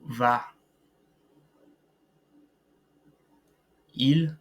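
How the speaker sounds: each form said clearly with pauses; slow careful conjugation drill; model conjugation. Shown as a demonstration. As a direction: model je vais to elles vont for repeating. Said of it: "pay close attention to the pronunciation".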